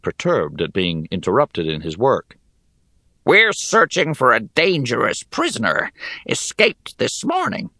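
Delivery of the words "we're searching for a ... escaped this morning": high, grating goblin voice. Acted. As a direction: sneer it like a goblin soldier.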